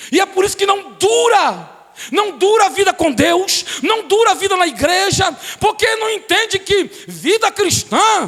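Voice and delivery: loud, high, fervent preaching over music; full-force preaching. Acted. Pastor proclaiming to the church, loud and fervent. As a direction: preach it out to the congregation.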